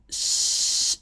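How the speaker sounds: "sh" as a long breath with no vowel; voiceless, drawn-out "sh"; unvoiced sh. Shown as a demonstration. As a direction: hold "sh" as pure air. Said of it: "is also unvoiced".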